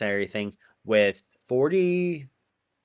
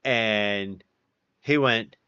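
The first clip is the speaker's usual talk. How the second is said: usual speaking voice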